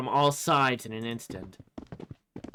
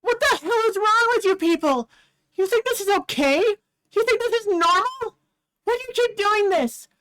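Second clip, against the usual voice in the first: high, loud, raging and close to tears; furious tearful girl; 6 dB louder than usual. Acted. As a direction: scream at the cruel fans to defend her idol. Reese yells at fans.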